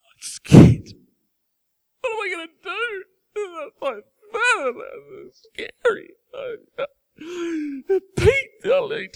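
high, breathless, panicky whisper; mock scared God. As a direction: play a frightened, fussing god for laughs. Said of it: "God does not up in heaven going".

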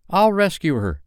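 put-on high, loud boyish resolve; heroic cry. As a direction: cry it out, ready for action. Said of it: "he cried".

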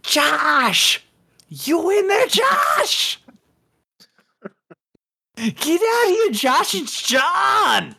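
high-pitched, drawn-out holler; shouting through door. Acted. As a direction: yell as if pounding on someone's door. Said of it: "like, pounding on it, being like".

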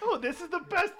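high, laughing cheerful shark voice; King Shark. Retold or imitated. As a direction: gush happily as a goofy monster. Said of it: "where King Shark's is like".

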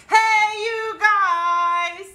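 high, drawn-out call; big stretched-out yell; movie catchphrase yell. Shown as a demonstration. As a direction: holler it long and high, stretching every word. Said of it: "Yeah, loudly yell".

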